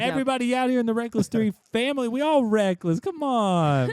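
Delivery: put-on Kentucky accent, hyping up the fans. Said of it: "That's his Kentucky accent coming out".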